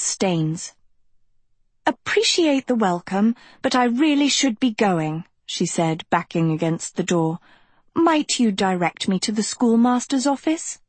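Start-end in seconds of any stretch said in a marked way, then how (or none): 1.9-5.2 put-on high, prim and polite girl; prim Sophie. 7.9-10.8 put-on high, prim and polite girl; prim Sophie.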